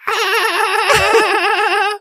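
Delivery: shrill squealing dolphin laugh, very high. Retold or imitated. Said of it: "had a laugh like a fucking dolphin".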